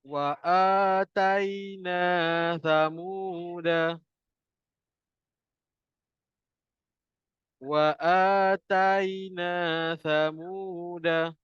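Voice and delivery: very slow drawn-out Quran recitation. Shown as a demonstration. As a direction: recite slowly for students to repeat. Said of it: "ikut saya baca".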